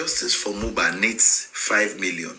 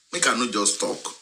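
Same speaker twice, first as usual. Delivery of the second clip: ordinary talk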